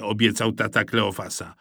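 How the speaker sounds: ordinary delivery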